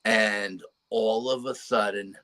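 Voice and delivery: ordinary talk, nothing marked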